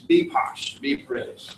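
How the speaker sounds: mock posh British accent; imitated British poshness. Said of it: "they just told me to be British".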